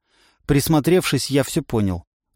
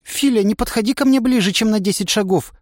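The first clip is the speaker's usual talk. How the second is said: high, frightened squeak; 8 semitones higher than usual. A narrator's reading. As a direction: squeak from behind the tree. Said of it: "пискнула девушка". (пискнула испуганно)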